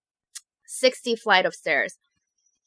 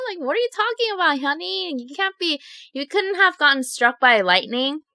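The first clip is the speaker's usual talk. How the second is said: amused disbelief; 6 semitones higher than usual. Retold or imitated. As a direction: tease her like a parent who thinks it's silly. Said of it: "They're, like, laughing".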